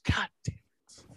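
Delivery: whispered under the breath; whispered curse. Acted. S0 grumbling after reading a condolence, whispered curse. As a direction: hiss it out in a whisper. Mock frustration aside.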